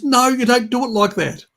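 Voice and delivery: high, loud mock objection; hammed-up fussy parent's protest; imitating upset dad. Carer mimicking dad correcting the yogurt method, mock outrage. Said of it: "He gets really upset with me".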